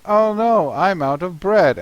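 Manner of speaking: a cartoon dinosaur wailing at an empty cupboard; dismayed Stegosaurus. Stegosaurus finds no bread, hammed-up dismay. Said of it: "our Stegosaurus says".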